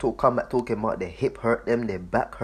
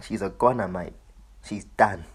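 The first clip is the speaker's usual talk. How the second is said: "mate" said as broad Cockney "mite"; Cockney accent; 2 semitones lower than usual. Shown as a demonstration. Switching out of his Benjamin accent to repeat the verdict, in Cockney. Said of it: "put on the, the, the, the Cockney one".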